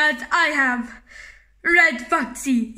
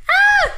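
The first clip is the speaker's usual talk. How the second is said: sharp high squeal; 6 dB louder than usual. Acted. Squeal during play.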